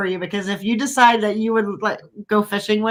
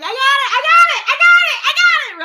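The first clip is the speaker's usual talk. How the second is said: much higher, louder, laughing shouts; excited shrieking; 4 dB louder than usual. Retold or imitated. Reeling in a fish, shouting with laughing excitement. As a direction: yell it over and over, giddy with the catch. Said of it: "I'm like".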